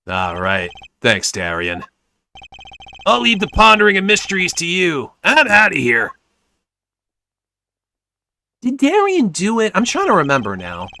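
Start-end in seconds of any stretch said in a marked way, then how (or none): 3.1-6.1 higher, louder character voice; pitched-up parting line; animated game character. Acted. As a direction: toss it off loudly on the way out.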